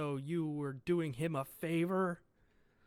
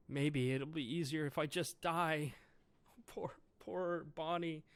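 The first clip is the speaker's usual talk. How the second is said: ordinary delivery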